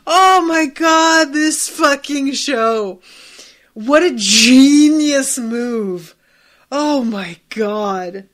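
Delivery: loud, slow, disbelieving delight; excited raving. Real, not acted. Telling viewers about a genius twist, loud excited raving. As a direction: gush about how brilliant it is.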